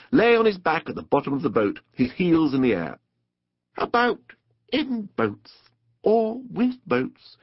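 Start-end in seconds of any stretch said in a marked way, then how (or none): 3.8-7.4 higher, slowing, unruffled musing; composed. A narrator's reading. Rat carries on after the crash, composedly. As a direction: carry on as if nothing happened. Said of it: "the rat went on composedly".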